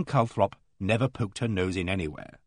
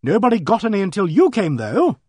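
high, loud accusation; accusing; 8 semitones higher, 7 dB louder than usual. A narrator's reading. Vicar's wife blaming the visitor for the letters, accusingly. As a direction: lay the blame squarely on the newcomer. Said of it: "said Missus Dane Calthrop accusingly".

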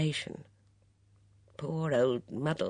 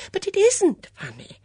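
put-on high, fluttery, worried old lady; a fussy old companion fretting; anxious old Dora; 10 dB louder than usual. Dora frets to Miss Blacklock about the notice, high and anxious.

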